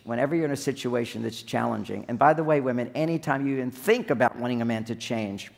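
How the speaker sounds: normal talking voice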